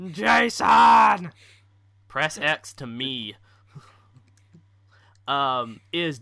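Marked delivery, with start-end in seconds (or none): 0.1-1.3 loud high call for Jason; shouting a name. Pretend. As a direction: yell "Jason!" like searching frantically. Heavy Rain meme shout.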